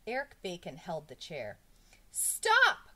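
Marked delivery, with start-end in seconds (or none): 2.1-2.9 very high, loud yell; alarmed schoolboy's cry. A narrator's reading. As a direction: shout in sudden alarm. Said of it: "cried Jason".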